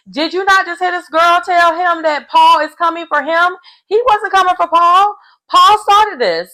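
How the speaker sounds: high-pitched indignant outburst; indignant disbelief. Acted. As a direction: protest in high, loud disbelief. Acted indignant reaction to the show.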